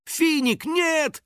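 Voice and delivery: high-pitched alarmed warning; frightened cry. A narrator's reading. Girl warning her friend of a trap, frightened cry. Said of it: "испуганно закричала Кристина".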